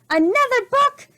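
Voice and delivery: pirate captain's high, loud outraged roar; furious roar. A narrator's reading. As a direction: explode with disgust at books. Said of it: "roared Captain Bones".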